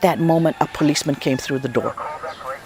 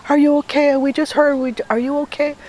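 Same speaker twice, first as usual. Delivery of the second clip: high, worried voices of the callers; high-pitched concerned relatives asking; 10 semitones higher than usual. Retold or imitated. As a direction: pitch it up as the anxious sisters calling. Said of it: "they all called from the States".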